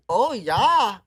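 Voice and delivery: loud, high "Oh, yeah" in a mock Dutch lilt; mock Dutch accent. Guessing which country wears clogs, loud mock Dutch accent. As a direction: belt out a cartoon Dutch "Oh, yeah". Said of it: "talk like".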